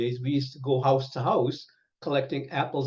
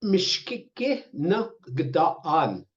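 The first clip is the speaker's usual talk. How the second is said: drawn out, syllable by syllable; very slow "Mishkike na gdaan"; slow teaching pronunciation; 3 semitones higher, much slower than usual. Shown as a demonstration. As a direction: model it slowly for learners. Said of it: "teaching question".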